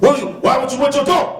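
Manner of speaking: very high, loud, fast tirade; imitating the sergeant berating the mother. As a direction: rattle it off in outrage.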